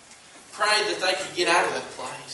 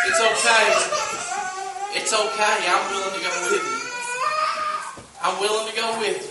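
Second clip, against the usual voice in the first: fervent, higher-pitched promise; impassioned reassurance; 4 semitones higher than usual. Acted. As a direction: assure them with a catch of emotion. Impassioned voicing of the comforting promise.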